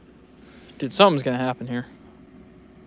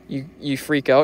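ordinary delivery

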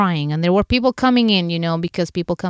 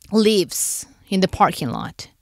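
ordinary talk

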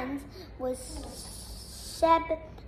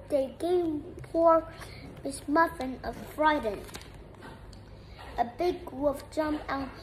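ordinary delivery, nothing marked

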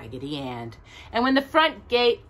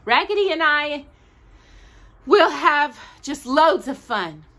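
put-on excited child; 9 semitones higher, 6 dB louder than usual. A narrator's reading. Marcella promising Grandma fun with the doll, high and eager.

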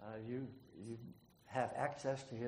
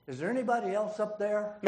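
high, loud holler up the cliff, half laughing; hollered punchline; 9 semitones higher, 13 dB louder than usual. A narrator's reading. Man dangling on a cliff hollering up, laughing. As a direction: shout it up, hoping for someone else.

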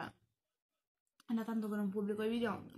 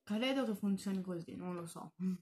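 speaking with food in mouth; mumbling between bites; chewing while talking. Real, not acted. Seen 1: Bites from fork at -3; talks with lips partly closed, mouth working.

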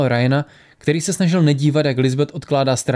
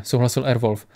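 usual speaking voice

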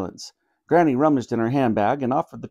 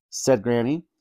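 usual speaking voice

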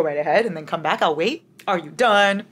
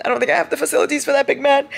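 1 rushed high; 8 semitones higher, faster than usual. Real, not acted. Overwhelmed by the preface.

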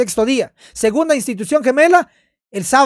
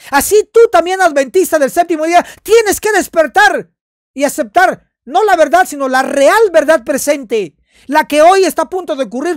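fervent preaching; 4 semitones higher, 4 dB louder than usual. Acted. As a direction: preach it hard to the believers. Preaching at full force.

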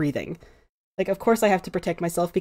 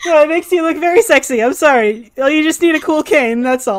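higher and louder, rushing to take it back; flustered backpedaling; 8 semitones higher, 8 dB louder than usual. Acted. Escher apologizing to Ember for the mean joke, flustered and higher. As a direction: scramble to undo the insult, half laughing.

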